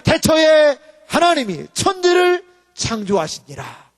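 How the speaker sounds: slow, loud scripture proclamation; proclaimed verse. Real, not acted. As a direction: declare the verse with full force. Preacher proclaims Genesis verse. (큰 소리로 천천히 선포)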